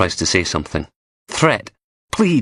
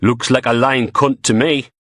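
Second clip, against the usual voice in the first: put-on Geordie accent; 5 semitones higher, 3 dB louder than usual. A narrator's reading. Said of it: "Elvis with a Geordie accent".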